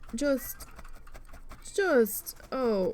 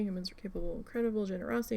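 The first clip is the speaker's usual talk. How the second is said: usual speaking voice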